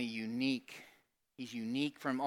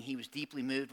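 normal talking voice

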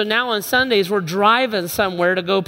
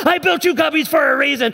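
exasperated yell; 5 semitones higher than usual. Retold or imitated. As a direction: scold them at full volume. Dad yelling.

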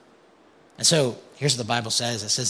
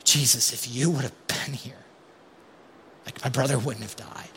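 hurt, subdued grief. Retold or imitated. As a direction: say it falling at his feet. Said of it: "I actually just wanna stay back".

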